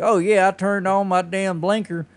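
high, drawn-out Southern drawl; Southern accent. Retold or imitated. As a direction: put on a slow, dim Southern voice. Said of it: "they always use a Southern accent".

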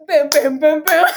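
loud, punchy booms; imitated floor slams. We were floor bitches in the door stack, loud imitated thuds. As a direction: hammer out each boom like a body hitting floor.

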